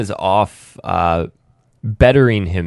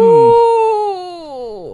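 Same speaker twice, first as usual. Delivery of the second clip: spooky ooh; 5 dB louder than usual. Acted.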